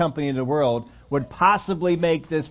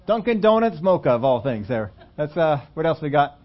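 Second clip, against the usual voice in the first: ordinary talk, nothing marked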